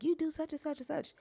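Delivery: high whiny mocking voice; whiny ex impression. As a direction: mimic a whiny ex-husband. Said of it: "ex-husband would be like".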